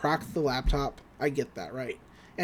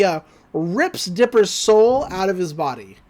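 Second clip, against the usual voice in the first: ordinary talk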